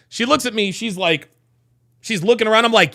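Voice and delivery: usual speaking voice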